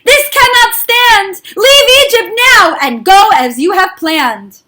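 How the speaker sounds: high, loud exasperated royal command; angry king. Acted. Paro gives in to Moshe, loud and exasperated. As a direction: snap the order out, fed up.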